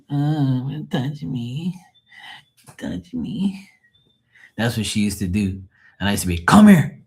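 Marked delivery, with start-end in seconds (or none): 0.1-3.7 dog's voice. Retold or imitated. Dog Diamond to her owner, high slow whine. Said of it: "she'll go like this". 6.4-6.9 high, loud call; calling the dog. Retold or imitated. Owner calling the dog Diamond, loud high call.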